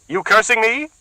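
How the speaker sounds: high, loud accusing woman; suspicious woman. Acted. Bertha accuses the muttering man, high and loud. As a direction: bristle with suspicion.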